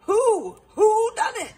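high, loud cry. A narrator's reading. Detective demanding the culprit, crying out. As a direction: call out the question, pitched right up. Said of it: "Hubert cried".

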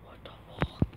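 hushed, soft disbelief; breath-only scared swearing; frightened whisper. Real, not acted. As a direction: whisper it in shock. Player frightened by what appeared.

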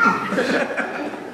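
airless, rising giggle; breathless laugh. Real, not acted. Mom's silly British accent cracks her up, breathless giggle. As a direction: laugh helplessly at the memory.

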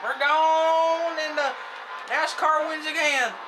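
high, loud, slow announcer call; race announcer. Pretend. Gamer calls his own win like a NASCAR announcer. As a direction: bellow it like a TV race caller.

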